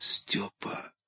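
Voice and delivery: faint whispered call; weak trapped cry for help. A narrator's reading. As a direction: call his name with no voice left. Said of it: "слабо позвала Бородулиха".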